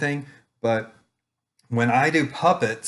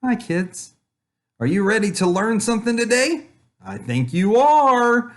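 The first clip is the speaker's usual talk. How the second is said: gently raised, easy puppet greeting; calm puppet voice; 10 semitones higher, 4 dB louder than usual. Shown as a demonstration. Puppet Julius greeting preschoolers calmly, lightly higher. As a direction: greet the little ones calmly through the puppet. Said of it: "he's gonna just sit here and go".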